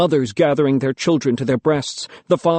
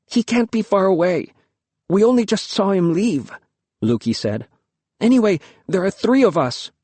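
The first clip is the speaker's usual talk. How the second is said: put-on young girl voice; 6 semitones higher than usual. A narrator's reading. Girl Luki argues with father.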